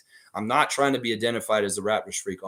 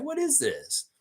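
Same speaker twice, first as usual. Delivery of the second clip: pitched high in mock disbelief, what is this; incredulous mock question; 4 dB quieter, slower than usual. Baffled fans reacting to the honors, mock disbelief. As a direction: play a baffled onlooker asking what is this. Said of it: "It's like".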